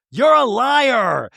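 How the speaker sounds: high, slow shouted accusation; hammed-up finger-pointing yell; mock accusation. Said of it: "of like".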